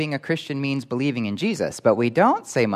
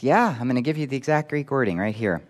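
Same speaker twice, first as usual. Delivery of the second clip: normal talking voice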